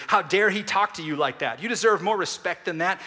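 indignant inner voice. Retold or imitated. Preacher voicing the tempting inner voice, loud and indignant. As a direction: stir up wounded pride. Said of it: "When the voice whispers".

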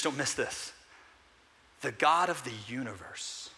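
ordinary talk

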